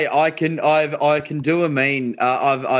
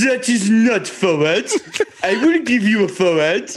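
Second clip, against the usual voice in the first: hammed-up French referee, slow and higher; Alain Roland; 5 semitones higher, 3 dB louder than usual. Comic imitating the referee ruling on a forward pass, arrogant French accent. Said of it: "How did he sound, Tommy, when he rolled the forward pass?".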